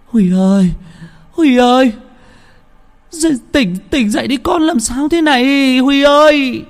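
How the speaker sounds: grandma pleading. Acted. Grandma shakes her son awake under the tree, high pleading grandmother voice. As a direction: shake him and plead, slowing on làm sao thế này. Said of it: "Bà nội tiến lại lay".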